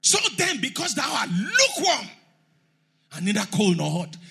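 high, loud preaching shout; shouted scripture. Real, not acted. Preacher shouting the verse at the congregation, high and loud. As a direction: cry it out like a warning.

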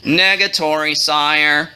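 low, listless monotone; slug-like boredom. A narrator's reading. Royal historian answering the king, low and utterly unexcited. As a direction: drone it with zero excitement. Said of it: "with the excitement of a slug".

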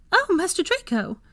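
very high startled house-elf; startled squeak. A narrator's reading. Elf caught unawares by master, startled squeak. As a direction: squeak it out in surprise. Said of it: "Tansy squeaked".

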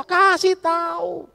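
slow, shouted high kasih tahu; loud urging. Shown as a demonstration. Pastor telling couples to speak up, loud and slow. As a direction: bellow kasih tahu slowly. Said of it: "dengan cara yang baik".

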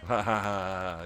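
mock hearty laugh in dad's accent; imitated dad laugh. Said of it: "him laughing like that".

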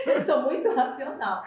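laughing. Real, not acted. Guest admitting she lacks creativity, laughing. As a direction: say it through a burst of laughter.

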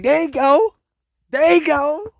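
high, bright "There you go" cheer. Retold or imitated. Girls cheering as he hits that ride, high and excited. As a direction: cheer him on like a fan.

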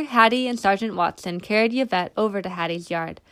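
ordinary delivery

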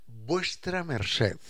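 vuestra merced drawn out slowly; courtly slow vuestra merced; slow deferential address. Shown as a demonstration. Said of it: "sino que le decía".

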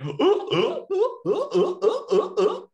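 a high gasping burst of mock nervous laughter; nervous funeral laugh. Host imitating a guest's laugh at a graveside, a high gasping giggle. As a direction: imitate someone cracking up at a funeral. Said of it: "gets that nervous laughter".

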